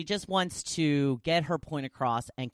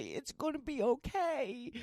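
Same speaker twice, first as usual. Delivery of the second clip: soothing; 11 semitones higher, 4 dB quieter than usual. Retold or imitated. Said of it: "scoop her up and say".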